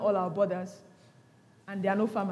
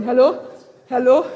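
pretend phone call; 8 dB louder than usual. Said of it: "you pick up your phone".

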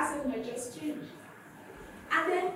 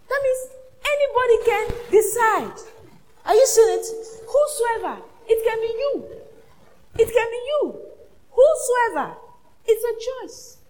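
much higher, loud preaching cry; full-force preaching; 10 semitones higher, 10 dB louder than usual. Acted. Pastor urging anyone to choose, full-force preaching. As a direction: ring it out, it can be you.

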